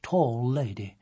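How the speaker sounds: plain reading voice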